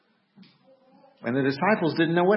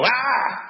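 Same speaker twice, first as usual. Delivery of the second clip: playful scare shout; 8 semitones higher than usual. Retold or imitated. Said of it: "and I go".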